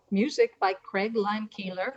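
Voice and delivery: ordinary talk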